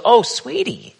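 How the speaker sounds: grandma kindly explaining the pan; gentle grandma. Retold or imitated. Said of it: "She goes".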